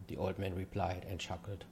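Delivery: plain reading voice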